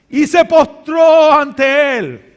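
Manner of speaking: drawn-out dramatic declaration; slow loud emphasis. Acted. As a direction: land the moment of surrender. Dramatic slowing.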